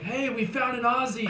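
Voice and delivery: high, loud excited holler; retold yell. Guys yelling across the road to friends, excited holler. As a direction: call it out to the whole party. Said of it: "they yelled out to all these guys across the road".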